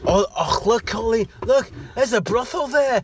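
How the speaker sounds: high, hammed-up Scouse voice; Scouse accent. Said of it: "Your Scouse accent needs work".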